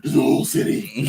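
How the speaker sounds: mock-heroic rasp; put-on vigilante voice. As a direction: growl it like a masked hero. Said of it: "Why are you talking like that?".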